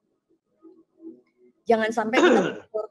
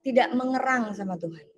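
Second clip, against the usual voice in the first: rising, louder preacher's appeal, higher each question; preaching; 3 semitones higher, 3 dB louder than usual. Acted. Pastor challenges online church about cold prayer, preaching. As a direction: press each "sudah berapa lama" harder.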